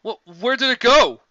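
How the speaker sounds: high, loud nervous stammer; stammering fright. A narrator's reading. Pony in the crowd, asking frightened. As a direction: stutter it out scared.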